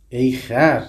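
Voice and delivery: loud sharp call; lion calling the hare a donkey; lion's shout. Acted. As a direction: bark the insult like the lion. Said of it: "این فریاد این شیر رو بشنو".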